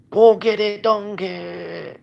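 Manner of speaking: high, loud goofy holler; silly comedy-bit voice. Retold or imitated. Vlogger imitating a video game bit, high goofy yell. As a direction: belt it out, high and drawn out. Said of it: "Just makes me think of Tim and Eric".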